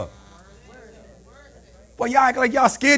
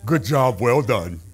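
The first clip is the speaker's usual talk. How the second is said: much lower, offhand praise; mock dismissive boss; 13 semitones lower, 5 dB quieter, slower than usual. Preacher imitating the boss brushing off a worker, low.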